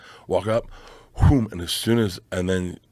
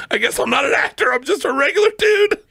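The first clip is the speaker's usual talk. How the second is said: rising, louder panicked confession, ending in a yell; embarrassed actor losing it, higher and louder; flustered panic; 3 dB louder than usual. Retold or imitated. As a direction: blurt it out, mortified and higher. Flustered retelling after the kiss.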